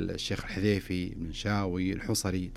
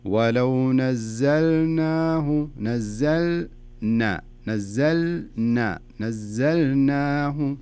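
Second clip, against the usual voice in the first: very slow, louder, each syllable of نزلناه stretched; slowly, for teaching; 4 semitones higher, 5 dB louder, much slower than usual. Shown as a demonstration. Teacher slows down so student hears the lam and nun. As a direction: break نزلنا apart and rebuild نزلناه slowly. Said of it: "جيبها بطريقة بطيئة شوي".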